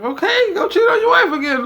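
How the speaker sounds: high, loud, laughing taunt; mocking laughter. Real, not acted. Mocking a cheating critic.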